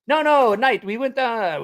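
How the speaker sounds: high, emphatic bad British imitation; botched British accent. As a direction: protest in a failed British accent. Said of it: "I just can't do a British".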